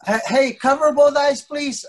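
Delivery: ordinary talk, nothing marked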